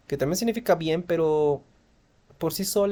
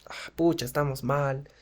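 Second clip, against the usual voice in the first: soft, dejected sigh of a voice; mock-miserable, hushed delivery; sad intonation; 4 dB quieter than usual. Shown as a demonstration. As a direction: let it sag, softly, as if miserable. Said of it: "demostrar con nuestra entonación".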